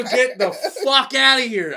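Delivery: loud, high, slow laughing disbelief. Retold or imitated. Hearing he missed the crash, loud laughing disbelief.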